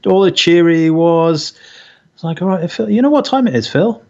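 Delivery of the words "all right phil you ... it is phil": mock telling-off. Wife mock-scolds early caller.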